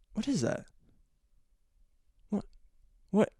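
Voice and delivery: high, hushed mock bewilderment; soft, halting "what", imitating his confusion; retold bewilderment. Son finds a dark shape below his recliner, bewildered.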